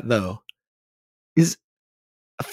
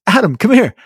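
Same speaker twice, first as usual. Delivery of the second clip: upbeat introducing call; 8 semitones higher, 6 dB louder than usual. Retold or imitated. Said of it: "your manager goes".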